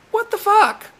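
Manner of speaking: very high, stunned disbelief; shocked outburst. Real, not acted. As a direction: cry it out, floored. Shock at bot reply.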